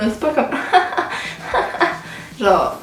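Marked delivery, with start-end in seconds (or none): 0.6-2.4 high wheezy breathless laugh; high airy cackle; ugly laugh demo. Shown as a demonstration. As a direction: show off your weird laugh. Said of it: "mon rire, c'est pas le plus beau".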